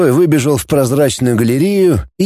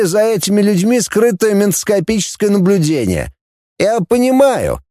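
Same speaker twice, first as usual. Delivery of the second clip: high, formal report voice; reporting security chief; 6 semitones higher than usual. A narrator's reading. Security chief briefs the ship commanders, high formal voice. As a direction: report to the assembled officers.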